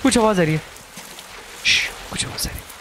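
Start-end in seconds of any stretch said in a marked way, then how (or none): 1.7-2.7 shush, then quick soft whispering; quick, secretive whisper after a shush; hushed whisper. Real, not acted. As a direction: whisper urgently so as not to be heard.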